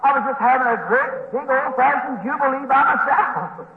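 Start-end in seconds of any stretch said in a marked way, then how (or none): none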